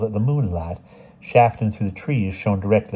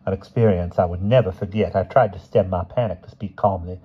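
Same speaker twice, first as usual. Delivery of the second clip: plain reading voice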